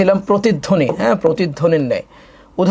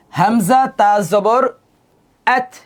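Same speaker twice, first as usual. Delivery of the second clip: very slow, louder spelling out; slow drill; 4 semitones higher, 5 dB louder, much slower than usual. Shown as a demonstration. Teacher spelling a non-qalqala letter aloud. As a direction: stretch each syllable like a teacher. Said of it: "এটা কিন্তু কলকলার হরফ না".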